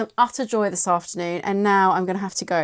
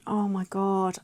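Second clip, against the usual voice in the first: ordinary talk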